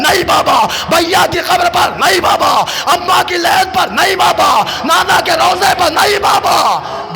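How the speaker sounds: high, loud grieving chant of the tale; wailing lament recitation. Acted. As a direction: cry the scene out to the mourners. Call and answer lament. (ऊँची आवाज़ में मातमी मरसिया ख़्वानी)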